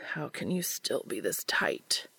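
low, slow, whispery groan. A narrator's reading. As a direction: groan softly under your breath, drawn out. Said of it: "Cass groaned".

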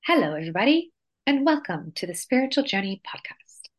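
imitating a British podcast host's intro with a lilting, sing-song rise; mock British accent. Co-host mimics her partner's British podcast intro, teasing. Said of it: "mimicking my accent in the way I introduce it".

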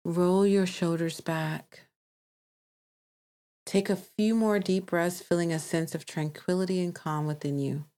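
calm meditation voice with long pauses; guided meditation. Acted. Host guides listeners to release emotions, calm meditation voice. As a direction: guide them gently, leaving space to breathe.

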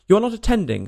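high, loud scolding; severe. A narrator's reading. Mouse catches Alice not listening, severe. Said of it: "said the mouse to Alice severely".

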